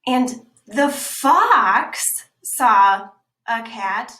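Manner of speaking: slow, loud stress on "fox"; slow storybook emphasis; slow emphatic reading. Shown as a demonstration. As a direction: draw out "fox" and land "saw a cat". Reading italic lines slowly.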